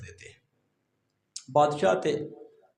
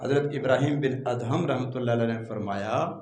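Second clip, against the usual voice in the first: ordinary delivery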